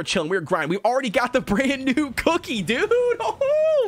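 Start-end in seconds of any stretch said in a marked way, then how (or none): 2.9-3.9 high laughing squeal; gleeful whoop. Real, not acted. Glad of the new cookie.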